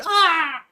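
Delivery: loud high mock yelp of a fake fall; hammed-up soccer dive scream; fake dive cry. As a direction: yelp like a diving footballer.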